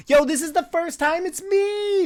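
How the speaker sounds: excited. Real, not acted. Excited streamer sees own answer.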